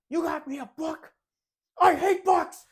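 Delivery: put-on high, loud, outraged yell of a little kid; kid brother's angry outburst. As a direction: shriek it like a furious seven-year-old. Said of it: "and he goes".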